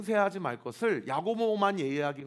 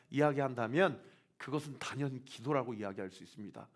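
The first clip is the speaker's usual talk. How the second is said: usual speaking voice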